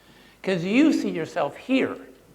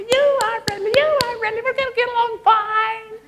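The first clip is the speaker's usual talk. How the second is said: much higher and louder, sing-song gushing; 8 dB louder than usual. Retold or imitated. Listener in a meeting sold on a friendly face, gushing high. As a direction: gush it out like a delighted new acquaintance. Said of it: "they've already cemented in their mind".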